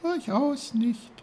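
high, slow, delicate little flower voice; a tiny snowdrop speaking gently. Acted. The snowdrop answers Uncle Pfefferkorn in a high little voice.

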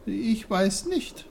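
put-on high, slow child character voice; timid little Peter. A narrator's reading. Peter answering the big bear, high and unsure.

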